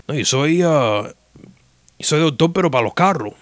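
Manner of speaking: lightly voiced old doctor admitting he fixes cars; a hint of the old Cuban doctor. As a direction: shrug it off casually, then reach for the English word. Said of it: "So that was Doctor Hill".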